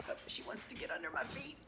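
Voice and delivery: whisper. Real, not acted. Vlogger whispering about the dog at her feet. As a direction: breathe it under your voice.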